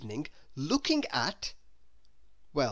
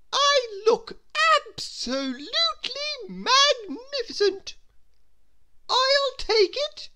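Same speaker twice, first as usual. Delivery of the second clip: haughty grand lady; 6 dB louder than usual. Acted. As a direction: savour every word like a vain lady. Miss Splendid admiring herself.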